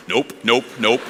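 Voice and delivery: low, soft repeated denial; dismissive refusal. Retold or imitated. Thomas told Jesus is alive, quietly dismissive. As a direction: murmur each refusal, unbudging.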